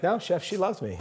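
high, lightly imitated Southern cook; Southern accent. Cook assuring chef the diner loves him, imitated Southern accent. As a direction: protest sweetly in a Southern voice. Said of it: "with this thick Southern accent".

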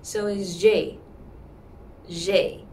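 J said with a downward Mandarin fourth tone; falling tone. Shown as a demonstration. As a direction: drop the J sound down. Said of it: "Mandarin fourth sound ... downward".

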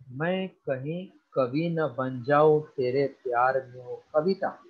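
recited on one low, level note; monotone. Shown as a demonstration. Teacher showing a class how not to sing a song, monotone. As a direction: drone through the verse without melody.

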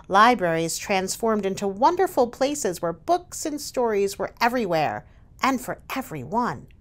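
usual speaking voice

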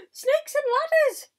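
very high, very loud shriek; horrified yell. A narrator's reading. Fire chief yelling at sight of spider, horrified yell. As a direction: shriek it in shock. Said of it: "yelled the head of the fire department".

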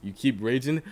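normal talking voice

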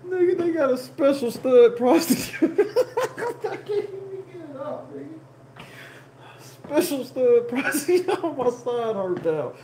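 high, wheezing with laughter; cracking up mid-sentence; laughing through words. Real, not acted. Laughing hard at his own stud prosecutor joke.

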